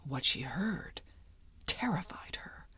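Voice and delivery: hushed, breathy spooky whisper. A narrator's reading. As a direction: drop to a scared whisper. Suspense reveal of a frightening sound.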